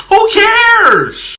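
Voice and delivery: high, loud "who cares"; exasperated yell. Acted. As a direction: throw it away with a loud, high shout. Running dismissive gag about the movie.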